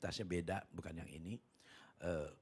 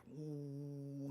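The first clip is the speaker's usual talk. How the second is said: soft, long ooh; imitated wave whoosh; 3 semitones higher, 8 dB quieter than usual. Huge wave heads for the ship, drawn-out whoosh. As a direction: draw out a soft sound of rising water.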